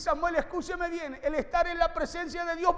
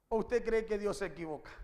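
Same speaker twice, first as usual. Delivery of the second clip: low, soft challenge to the listeners; quiet, probing pulpit question; hushed rhetorical question; 8 semitones lower, 7 dB quieter than usual. Real, not acted. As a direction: drop the voice right down to pose the question.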